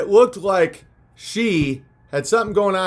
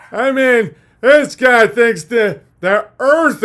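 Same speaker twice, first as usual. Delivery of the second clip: mocking critic; 4 semitones higher, 5 dB louder, slower than usual.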